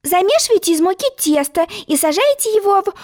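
put-on high, eager girlish explaining; eager young girl. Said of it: "с готовностью стала объяснять".

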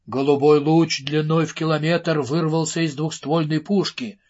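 usual speaking voice

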